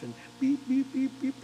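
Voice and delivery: high, sing-song beeps like a heart monitor. Retold or imitated. Describing the intensive care room, mimicking the beeping monitor. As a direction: imitate the hospital monitor beeping.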